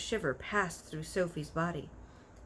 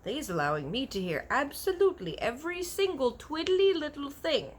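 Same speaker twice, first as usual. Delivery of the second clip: giant's voice; 9 semitones higher, 5 dB louder than usual. A narrator's reading. BFG voiced in a story.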